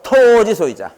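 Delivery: 토 in 토지 소유자 stretched high and loud; memorable emphatic reading. Shown as a demonstration. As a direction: hit a long, loud, high 토, then fall through 지 소유자. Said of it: "뭐라 읽자고?".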